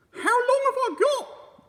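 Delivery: put-on a fretful old wife squawking high; old wife voice, high and loud; Mrs Twit. Mrs Twit's dialogue.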